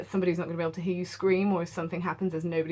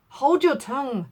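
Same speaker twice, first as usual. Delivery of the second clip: higher, sharp hint of a snap; fierce; 7 semitones higher, 6 dB louder, slower than usual. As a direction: tell the maid to hush fiercely. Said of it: "with a ferocity of accent".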